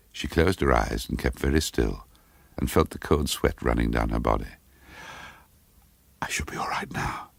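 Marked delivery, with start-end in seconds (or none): 6.2-7.3 shaky whispered self-reassurance. Acted. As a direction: breathe it out to calm yourself. Shaken, calming herself after vertigo.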